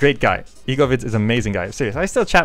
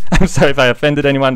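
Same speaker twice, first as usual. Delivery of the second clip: louder, through real laughter; laughing apology; 2 semitones higher, 8 dB louder, faster than usual. Real, not acted. Vlogger apologizing after failed accent demo, laughing. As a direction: apologize while cracking up.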